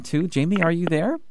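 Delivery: usual speaking voice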